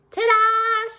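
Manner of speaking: high, bright reveal, loud and proud; mother showing off her work; excited ta-da. Acted. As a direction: announce it like a magic trick. Mother unveils clown makeup.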